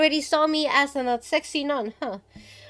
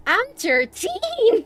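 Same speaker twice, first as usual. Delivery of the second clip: put-on high, drawn-out girlish announcement; young girl; 11 semitones higher, 4 dB louder, much slower than usual. Girl reveals her age to the streamer, high and drawn out. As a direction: pipe up high and slow as the girl.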